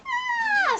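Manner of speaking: very high acted squeal; scream. A narrator's reading. Three kids surprised at the corner, screaming. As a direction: shriek it high in fright. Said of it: "screamed Frank Junior".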